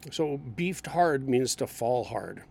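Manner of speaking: normal talking voice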